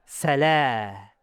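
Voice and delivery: long open "Salah" released through the mouth. Shown as a demonstration. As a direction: let the a of "Salah" out freely. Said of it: "Suara mulut".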